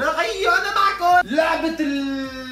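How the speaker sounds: high, loud slow call-out. Real, not acted. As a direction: shout it to get attention back. Loud return to the audience.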